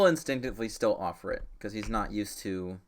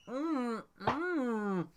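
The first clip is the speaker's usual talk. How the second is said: high muffled hums through a full mouth; 13 semitones higher, much slower than usual. Acted. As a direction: hum through a stuffed mouth.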